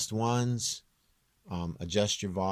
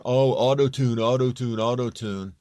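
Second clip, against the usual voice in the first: whiny mock complaint, louder at first and then fading; mocking auto-tune critics; 4 semitones higher, 7 dB louder than usual. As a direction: parody the critics who moan about auto-tune. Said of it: "for people that, that are".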